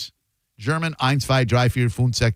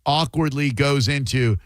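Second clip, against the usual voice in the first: usual speaking voice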